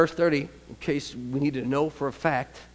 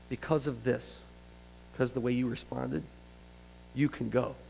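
softer, tender release; gentle Jesus; 2 semitones lower, 10 dB quieter than usual. Acted. Preacher voicing Jesus releasing the woman, tender. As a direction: speak it gently, granting mercy.

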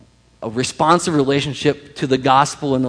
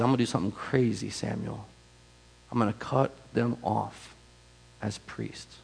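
solemn and hushed, trailing almost to nothing; grave, hushed warning; 2 semitones lower, 11 dB quieter than usual. Retold or imitated. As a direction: quote God softly, letting it fade away. Voicing God's grave warning to Samuel.

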